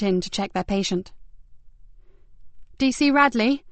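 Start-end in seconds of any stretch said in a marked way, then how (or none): 2.8-3.6 put-on a lightly raised call from a young officer; young constable calling. Constable calls to Ben from the doorway, a touch louder. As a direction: call his name across the room.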